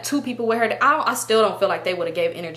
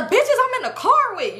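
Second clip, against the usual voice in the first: high, indignant mock yell; retold screaming; 13 semitones higher than usual. Answering sister on the phone, retold high scream. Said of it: "I'm in the backseat screaming".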